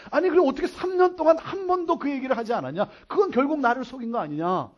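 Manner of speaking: high-pitched, insistent confrontation; aggrieved outburst; indignant accusation. Acted. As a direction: press the accusation in an acted quarrel. Acted quarrel, accusation repeated.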